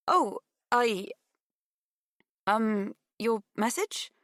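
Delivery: hesitant, high, puzzled stammer; stuttering confusion. A narrator's reading. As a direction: falter slowly into a confused question. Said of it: "Pip stuttered".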